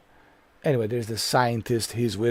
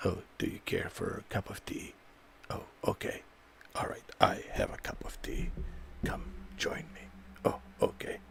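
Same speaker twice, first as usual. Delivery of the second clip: whispery, very soft mock of a polite tea-drinker; mocking unmanly voice; 6 semitones lower, 14 dB quieter than usual. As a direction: whisper it like a dainty tea invitation. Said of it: "should have more like a manly voice".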